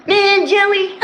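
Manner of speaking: playful imitation of annoyed friend; mock mad John. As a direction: lightly imitate a friend's gripe. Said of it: "sounded like you were mad at Jelly".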